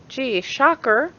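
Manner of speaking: high, drawn-out mock surprise; sarcastic. Real, not acted. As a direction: feign amazement, slowly and sarcastically. Sarcastic reaction to the contradiction.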